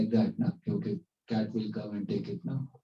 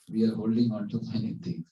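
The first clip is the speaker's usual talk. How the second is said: ordinary delivery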